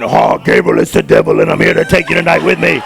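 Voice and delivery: devil voice. Retold or imitated. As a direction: growl it out like a demon under the bed.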